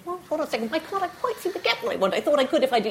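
puzzled inner voice. Retold or imitated. Interviewer voices a woman's sudden second thoughts, puzzled. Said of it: "you suddenly think".

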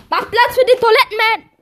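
superhero announcer. Acted.

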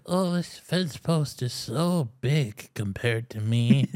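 slow, airy mock-innocent voice; mocking imitation. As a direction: drawl it like a fragile influencer. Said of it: "be like".

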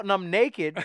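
ordinary talk, nothing marked

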